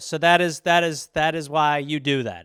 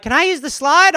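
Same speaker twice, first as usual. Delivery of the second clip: put-on high, loud little kid voice; mock kid asking; 10 semitones higher, 6 dB louder than usual. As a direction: ask sweetly like a small child. Kids asking at playground.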